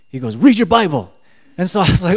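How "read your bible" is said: loud, high-pitched retold order; acted-out emphatic insistence; insistent command. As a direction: order it forcefully, voice raised. Inner voice insists, louder second time.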